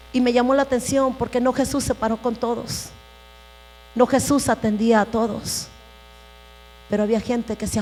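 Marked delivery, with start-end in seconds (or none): none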